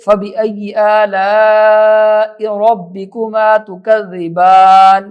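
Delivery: slow tajweed with long held vowels; Quran recitation. Shown as a demonstration. Teacher demonstrating a verse to learners, slow recitation.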